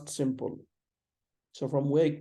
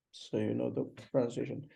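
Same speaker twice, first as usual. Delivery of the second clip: usual speaking voice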